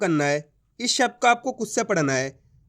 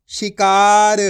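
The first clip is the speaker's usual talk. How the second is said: शिकार said at normal speed, louder and firm; firm whole word; 5 dB louder, much slower than usual. Shown as a demonstration. Teacher giving the blended word शिकार, louder and firm. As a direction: land शिकार as one clear word. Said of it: "blended word after sounding out".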